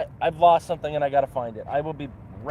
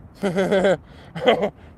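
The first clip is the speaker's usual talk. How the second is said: short burst of laughter, higher pitched; amused laugh; 4 semitones higher, 6 dB louder than usual. Real, not acted. Streamer reacts to being asked if they sound Russian, amused laugh. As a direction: laugh out loud at the very idea.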